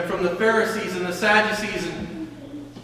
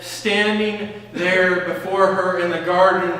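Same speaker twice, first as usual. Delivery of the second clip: ordinary talk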